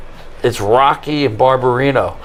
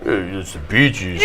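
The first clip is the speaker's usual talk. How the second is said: low dismissive voice; 9 semitones lower, 6 dB quieter than usual. Retold or imitated. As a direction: drop low and flat, dismissive.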